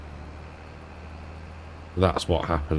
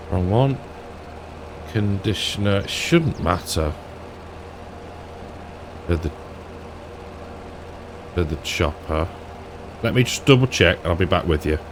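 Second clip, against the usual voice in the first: ordinary talk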